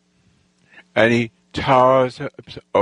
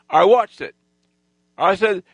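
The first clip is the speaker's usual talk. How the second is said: ordinary delivery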